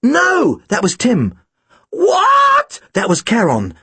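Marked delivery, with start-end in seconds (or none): 0.0-0.6 high-pitched desperate no; alarmed cry. A narrator's reading. Tim hearing the killer approach his brother, alarmed. 1.9-2.8 very high, loud wailing question; startled shriek. A narrator's reading. Killer interrupted by Tim in darkness, shrieking.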